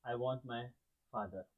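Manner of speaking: understated sobbing. A narrator's reading. Lost child begging for his mother and father, softly sobbing. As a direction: let the plea sink as if holding back tears. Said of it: "He was sobbing, he was crying".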